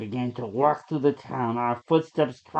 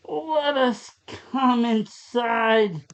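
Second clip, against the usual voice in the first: higher, very slow plea; begging; 10 semitones higher, 3 dB louder, much slower than usual. Acted. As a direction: stretch the plea out, desperate. Narrator begs man through glass.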